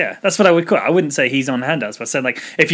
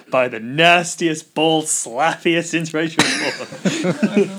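slow quote; much slower than usual. Retold or imitated. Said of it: "first episode of Archer".

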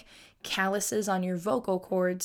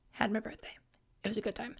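soft unvoiced whisper; 8 dB quieter, faster than usual. Telling listeners about her birthday in a whisper. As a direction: murmur it like a secret aside.

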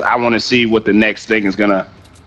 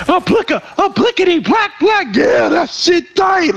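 loud mocking impression; slower than usual. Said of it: "He said you was over there".

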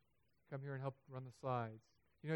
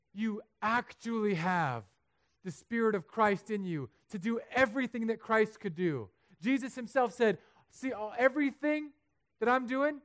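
loud, high preaching climax; booming pulpit delivery; full-force preaching; 9 semitones higher, 11 dB louder, slower than usual. Real, not acted. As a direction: preach it to the rafters.

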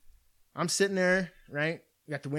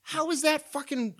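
indignant; 12 semitones higher, slower than usual. Real, not acted. Ranting about panhandling.

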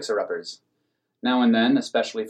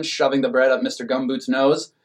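plain reading voice

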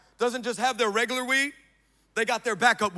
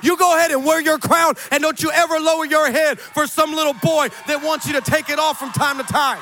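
high, loud, driving exhortation; full-force preaching; 7 semitones higher, 8 dB louder than usual. Real, not acted. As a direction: preach it hard, urging them to stand tall.

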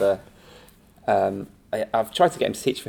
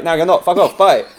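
mock brutal dismissal; 6 semitones higher, 7 dB louder than usual. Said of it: "then he's like".

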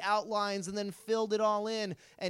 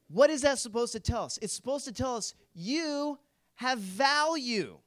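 normal talking voice

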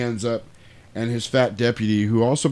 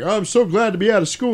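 high-pitched child imitation; mock schoolkid; 11 semitones higher, 3 dB louder than usual. Kids glad to be out of school, mock child voice. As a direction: chirp it as a relieved pupil.

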